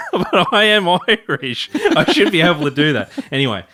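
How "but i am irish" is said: hammed-up Irish accent, laughing; drawn-out, higher mock Irish accent. Husband protesting his wife's tease, in a laughing mock Irish accent. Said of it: "Better than your Irish accent".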